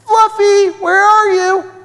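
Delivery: loud, high searching call; shouting. A narrator's reading. Children searching the park for a lost puppy, shouting. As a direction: holler for a missing pet in a high voice. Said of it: "they shouted again".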